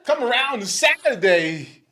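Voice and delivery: Christopher Walken. Retold or imitated. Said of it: "Christopher Walken".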